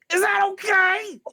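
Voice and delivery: high, slow, loud eager question; eager bugbear. Acted. Bugbear cook checks the coffee with the player, high and eager. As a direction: ask hopefully, drawn out. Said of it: "into the coffee and goes".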